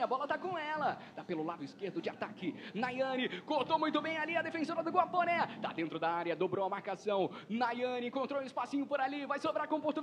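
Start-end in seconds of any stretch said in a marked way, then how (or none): none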